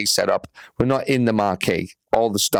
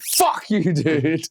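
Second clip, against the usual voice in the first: mock outraged; 7 semitones higher, much slower than usual. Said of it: "I was like".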